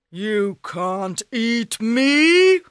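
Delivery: slow, loud ogre scoff; scornful ogre. Acted. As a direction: sneer it down at the cat.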